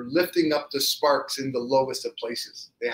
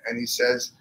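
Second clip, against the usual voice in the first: usual speaking voice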